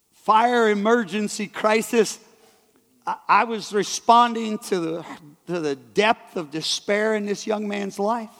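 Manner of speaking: ordinary talk, nothing marked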